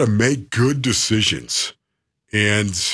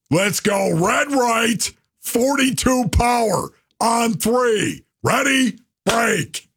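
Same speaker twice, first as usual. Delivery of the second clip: raised, firm play call, punched out slowly; confident quarterback calling the play; commanding huddle call; 11 semitones higher, slower than usual. Shown as a demonstration. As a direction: take charge of the huddle and bark the play. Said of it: "look people in the eye, and say the play".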